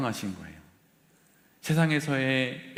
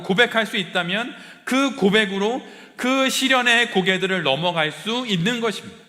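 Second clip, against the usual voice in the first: higher and louder, preaching at full force; impassioned preaching; 9 semitones higher, 8 dB louder than usual. Acted. Pastor pressing the congregation about who they are, impassioned preaching. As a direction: drive each line home like a rising sermon climax.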